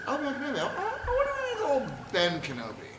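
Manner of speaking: high-pitched wondering voice; Luke. Acted. Luke hearing Leia's message, high wondering voice. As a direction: muse aloud in a high, naive imitation. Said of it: "And Luke says".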